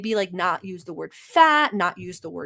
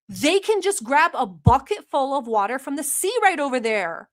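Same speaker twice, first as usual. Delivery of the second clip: heated mock rant at the chat; 5 semitones higher than usual. Host yells at haters in her chat, a mock heated rant. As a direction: scold the chat like they're dumb, half-joking. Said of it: "I'm sorry to yell at you guys like that".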